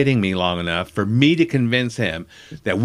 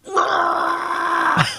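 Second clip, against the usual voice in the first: imitated monster roar, strained and high; long mock rage roar; Incredible Hulk. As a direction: roar like an enraged comic-book brute. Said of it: "was the Incredible Hulk, Lou Ferrigno".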